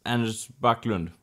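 put-on low, soft Swedish accent. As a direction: say your name in a Swedish accent. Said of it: "I do a little Swedish, Swedish accent there".

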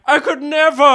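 high, loud melodramatic wail; hammed-up cry of defeat; mock despair. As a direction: refuse as if utterly hopeless. Friend's dramatic refusal, acted.

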